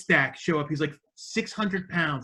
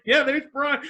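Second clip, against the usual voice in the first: imitated happy greeting; 10 semitones higher than usual. Violent J spotting his friend on screen, happy cheer. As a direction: cheer like seeing an old buddy. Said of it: "He was like".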